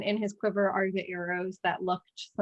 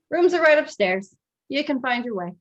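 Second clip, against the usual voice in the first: slightly higher, a bit louder character line; lightly put-on higher voice for an innkeeper; lightly voiced innkeeper; 5 semitones higher, 4 dB louder than usual. Game master as innkeeper sends guests upstairs, lightly voiced.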